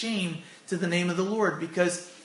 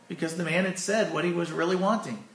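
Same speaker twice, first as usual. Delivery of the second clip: ordinary delivery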